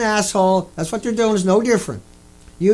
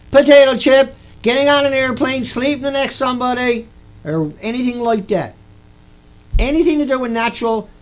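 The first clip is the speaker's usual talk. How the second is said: ordinary talk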